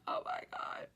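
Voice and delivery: hushed, scared gasp of words; terrified whisper. Retold or imitated. Told to jump from sliding car, whispering in fear. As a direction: breathe it out in fear.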